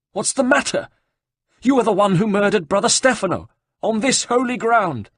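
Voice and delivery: high, loud, outraged accusation. Acted. Abbot accuses the killer, high and outraged. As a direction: hurl the question back at him in outrage.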